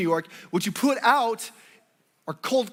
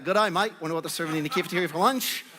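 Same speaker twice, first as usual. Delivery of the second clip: quick put-on Australian accent; 3 semitones lower, faster than usual. Teasing a schoolmate about lunch, in a fake Australian accent. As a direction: sneak in a breezy Australian accent. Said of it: "sneak in some Australian accent".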